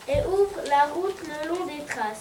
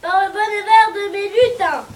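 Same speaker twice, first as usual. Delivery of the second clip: loud, raised exclamation; big, higher-pitched storybook outburst; Father Christmas exclaiming; 4 semitones higher, 10 dB louder than usual. A narrator's reading. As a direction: cry out in astonishment. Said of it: "crie-t-il".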